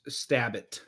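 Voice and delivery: ordinary talk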